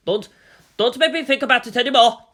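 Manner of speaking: loud, high, frantic begging; rising panic, voice pushed high and loud; panicked plea. Acted. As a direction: beg him to stop making you think. Panicked passenger pleads with driver.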